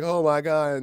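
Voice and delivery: loud, high, overwhelmed exclamation; mock awe. New Planeteer gets confirmation, mock awe. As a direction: blurt it out, hammed-up thrill. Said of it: "I was like".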